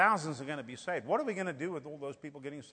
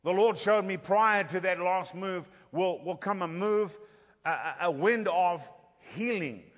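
raised, loud revival preaching, slowing on key phrases; fiery preaching; 4 semitones higher, 6 dB louder than usual. Acted. Preacher telling the church of coming miracles, at full force. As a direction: drive the vision home to the congregation.

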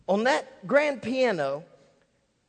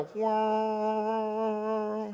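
long droning whirr of a struck note; imitated piano beat; 3 dB quieter than usual. Pastor mimicking the piano note he heard, droning. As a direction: hold the hum of an untuned string. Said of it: "I heard a".

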